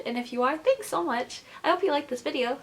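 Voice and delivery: ordinary talk, nothing marked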